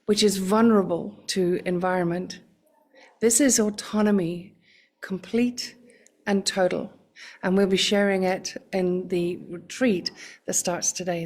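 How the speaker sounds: ordinary talk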